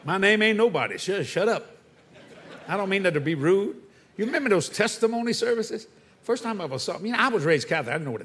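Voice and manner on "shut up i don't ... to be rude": ordinary talk, nothing marked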